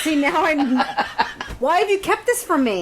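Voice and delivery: high, incredulous protest through amusement; high-pitched playful accusation; mock outrage. Real, not acted. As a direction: demand it in amused disbelief. Mock outrage at the accent reveal.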